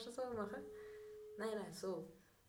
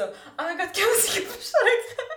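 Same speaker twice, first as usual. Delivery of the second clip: panicked squeal; 11 semitones higher, 11 dB louder than usual. Retold or imitated. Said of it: "hat Lorena so".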